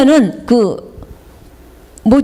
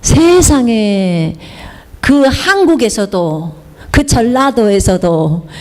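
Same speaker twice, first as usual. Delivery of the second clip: ordinary talk, nothing marked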